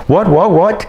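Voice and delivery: startled. Retold or imitated. Jolted awake by a ghost.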